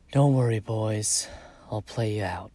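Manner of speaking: loud, ringing farewell call. Acted. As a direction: call it out across the bluff. Calling out to departing ghosts.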